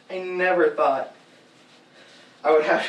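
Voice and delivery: usual speaking voice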